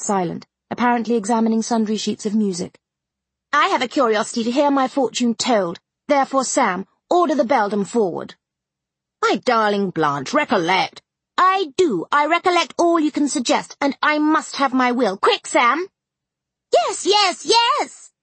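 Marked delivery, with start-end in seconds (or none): 3.5-15.9 higher, commanding haughty heiress; spoiled beauty insisting on her will; imperious young lady. A narrator's reading. Blanche's imperious lines. 16.8-18.1 much higher, excited chorus of yes; young guests clamouring; young party crying out eagerly. A narrator's reading. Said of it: "cried all the juveniles".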